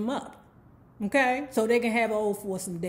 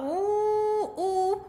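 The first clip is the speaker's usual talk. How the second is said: high, drawn-out admiring oohs; swooning delight; 10 semitones higher than usual. Retold or imitated. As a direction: coo with mock excitement, pitched way up. Winter swoons over Boom and car.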